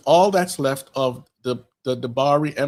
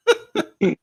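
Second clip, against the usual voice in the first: a sudden high, loud burst of laughter; high mocking laugh; 3 dB louder than usual. Real, not acted. Laughing at the movie's plot, high and loud. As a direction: cackle at how silly the plot is.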